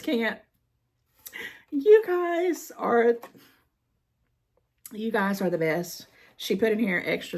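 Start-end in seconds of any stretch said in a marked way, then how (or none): 1.7-6.1 choked up. Real, not acted. Moved by the extra stamps.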